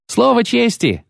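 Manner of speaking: put-on very high, boyish knight; youthful voice. A narrator's reading. Second knight echoing the first, youthful voice. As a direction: pipe up eagerly like a young squire. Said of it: "подхватил юношеским голосом другой".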